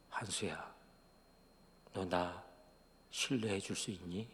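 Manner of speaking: very soft, low voice; quiet, low voice of God; God speaking inwardly. Retold or imitated. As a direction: ask gently, dropped almost to a hush. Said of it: "그때 하나님이 저의 마음속에".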